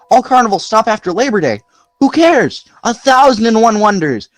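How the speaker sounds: put-on high eager boy reading out; excited reading. A narrator's reading. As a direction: read the wonders with awe.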